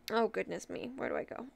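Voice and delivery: breathy muttered aside to herself; whispering to herself; whispered aside. Real, not acted. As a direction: drop to a whisper, thinking aloud. Muttering to herself mid-list.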